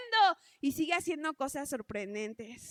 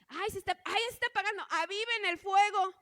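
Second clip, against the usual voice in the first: higher and louder, a worried shout to feed the fire; urgent campfire call; 5 semitones higher, 6 dB louder, faster than usual. Retold or imitated. Campers calling out to stoke the fading fire, urgent and raised. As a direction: sound the alarm that the fire is dying.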